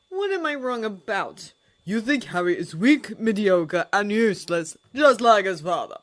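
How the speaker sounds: scornful loud accusation; spat. A narrator's reading. Holmes accusing Snape, spitting contempt loudly. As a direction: spit the words out. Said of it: "Holmes spat".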